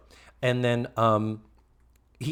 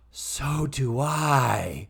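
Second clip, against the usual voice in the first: very drawn-out mock drunk line; gasp, then stretch each word tipsily; Tootie playing drunk; much slower than usual. Host as drunk Tootie nose to nose, slow mock-drunk drawl. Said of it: "Playing drunk".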